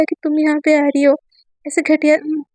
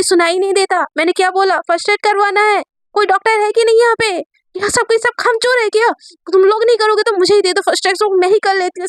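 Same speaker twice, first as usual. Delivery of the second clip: higher, pushy, panicked complaint; frantic yelling at staff; 6 semitones higher than usual. A narrator's reading. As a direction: demand help like a panicked friend. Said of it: "सब लोग ना चिल्लाने लगती है". (घबराहट में ऊँची आवाज़ में चिल्लाना)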